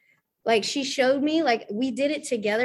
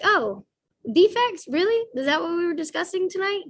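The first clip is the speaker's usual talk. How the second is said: mock surprise; 8 semitones higher, 3 dB louder than usual. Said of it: "I was like".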